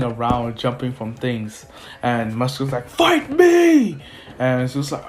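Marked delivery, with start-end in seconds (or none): 2.9-4.0 high, loud battle cry; hammed-up villain roar; villain's challenge. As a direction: bellow the challenge.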